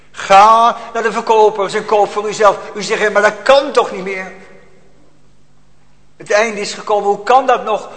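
loud, high, urgent sermon climax; full-force preaching. Acted. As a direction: preach it out with fire. (luid vurig preken)

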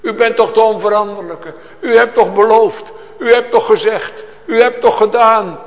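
loud, high, insistent pleading to God; pleading prayer. Retold or imitated. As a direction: cry out to the Lord, pressing him on his promises. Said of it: "Dan gaan ze de Heer aanspreken".

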